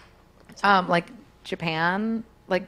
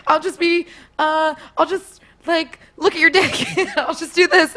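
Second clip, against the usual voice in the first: put-on high, hesitant girlish voice with giggles; mock teen innocence; 9 semitones higher, 4 dB louder than usual. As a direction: play coy and unsure, half laughing. Continues pretend teenage role-play.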